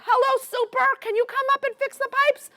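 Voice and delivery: high-pitched mock phone call to the super; acted-out helpless renter's call; tenant calling the super. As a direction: pitch it way up, hailing the building super. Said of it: "I'm used to calling".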